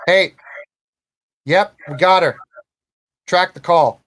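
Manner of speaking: an agent telling his team to trace the call. Acted. Agent calling to colleagues.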